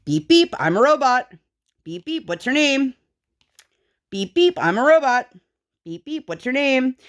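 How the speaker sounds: imitated piping robot-game voice; child playing robot. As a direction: chirp it relentlessly like a child being a robot. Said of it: "all she kept doing was going".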